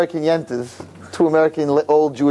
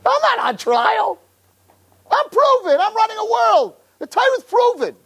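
God's voice. Retold or imitated. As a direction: speak as God, unbothered and sure. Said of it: "Hashem's like".

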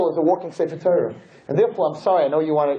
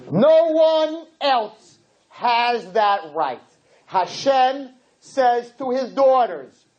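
preaching; 10 semitones higher, much slower than usual. Acted. Preaching climax, slow and high.

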